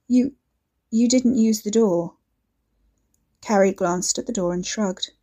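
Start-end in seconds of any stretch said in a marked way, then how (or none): 0.1-2.2 shaken, teary, stumbling over you; tearful stammer. Acted. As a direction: repeat it close to tears. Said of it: "eyes watering".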